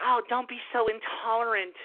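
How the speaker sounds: mock a preachy liberal lecturing you; a hint of a whiny lecturing voice. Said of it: "they wanna be like".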